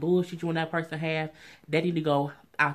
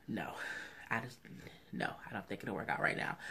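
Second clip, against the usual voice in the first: whispering; 7 semitones lower, 14 dB quieter, faster than usual. Real, not acted. Aside dropping to a whisper.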